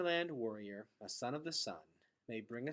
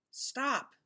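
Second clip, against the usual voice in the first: a sharp high yell; 12 semitones higher, 3 dB louder than usual. A narrator's reading. Gregor running onto the stage, breathless yell. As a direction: cry out to halt everything. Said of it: "yelled Gregor".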